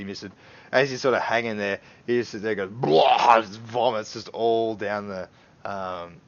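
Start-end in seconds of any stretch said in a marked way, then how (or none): 2.7-3.4 loud hammed-up retch; vomiting noise. Drunk man hanging by Chug, loud mock vomit sound.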